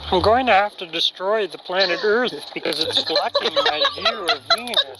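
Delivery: nasal cartoon alien voice; Marvin the Martian. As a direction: do a Looney Tunes alien. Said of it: "do your Marvin the Martian impression".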